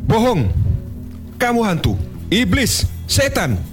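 shrill panicked cry, very high; terrified shout. Acted. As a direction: scream accusations in fear. Man terrified of ghostly figure.

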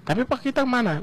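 put-on a kid tugging at dad's sleeve; child's voice. Son asking father where they go, high childlike voice.

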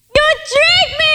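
high, accusing cry of betrayal; cried out. A narrator's reading. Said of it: "Aladdin cried".

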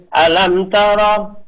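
normal talking voice

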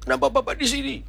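high, startled question; acted surprise, rising at the end. Maid comes to and sees the pastor, startled. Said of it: "Dia kaget lihat saya".

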